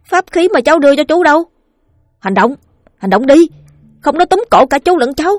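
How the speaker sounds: put-on a boy pleading hard, high and loud; urgent boy's voice. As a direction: push the scared uncle to act now. Boy urging uncle to act.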